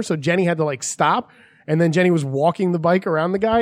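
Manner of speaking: ordinary talk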